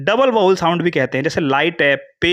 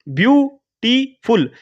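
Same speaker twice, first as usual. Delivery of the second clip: Beautiful very slow and higher, three beats view, टी, फुल; split into syllables; 6 semitones higher, much slower than usual. Shown as a demonstration. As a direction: break beautiful into view, टी, फुल with clear pauses. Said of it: "थोड़ा-थोड़ा सा break लिया".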